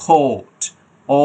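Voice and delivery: "কোর্ট" with a long held "ও", silent r. Shown as a demonstration. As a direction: stretch the vowel in "কোর্ট". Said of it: "সাউন্ডটা লম্বা টান হবে". ("কোর্ট" লম্বা টান দিয়ে)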